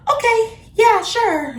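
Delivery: high squeaky sarcastic "okay, yeah, sure"; mock fake agreement. Sarcastic fake agreement she refuses to give the boy. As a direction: pitch it way up, fake-sweet and sarcastic. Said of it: "Am I supposed to be like".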